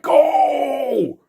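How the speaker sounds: high, loud mock cry of "Go!"; mock shout. Thug in the back urging his pals on, in a high, loud mock shout. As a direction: fling out a hammed-up "Go!".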